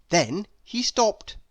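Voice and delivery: ordinary delivery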